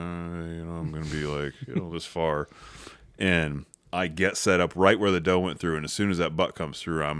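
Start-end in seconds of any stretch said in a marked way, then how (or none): 0.0-2.5 very soft, under the breath; inner thought. Retold or imitated. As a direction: think it quietly to yourself. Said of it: "in my mind, I'm like".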